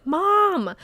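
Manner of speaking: retold scream. Trying to wake mom on the couch, retold scream. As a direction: cry out, pitched well up, as a retold yell. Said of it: "I'm screaming at her".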